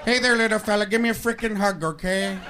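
mock-menacing villain monologue; petulant movie villain. Acts a film villain figure.

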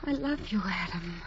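low, soft, fading; faint tender confession. Acted. Dying woman tells Adam she loves him, faint and low. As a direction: barely breathe his name.

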